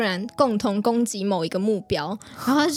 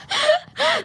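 high, loud giggle; quick burst of laughter; short high laugh; 4 dB louder than usual. Real, not acted. Laughing about the meal never bought.

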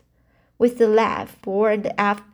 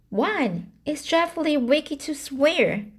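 higher, scandalised scolding; rebuking; 7 semitones higher than usual. A narrator's reading. Dinah rebukes Anne for talking of swearing, shocked. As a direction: scold her friend for swearing. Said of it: "she said rebukingly".

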